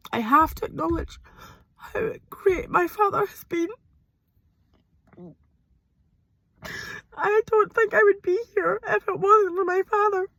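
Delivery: speaking through real tears; crying. Real, not acted. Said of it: "I'm so sorry for crying".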